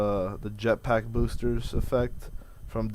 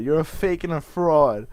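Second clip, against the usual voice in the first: Livia Soprano voice; 5 semitones higher, 6 dB louder than usual. Retold or imitated. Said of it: "I spoke like Livia Soprano".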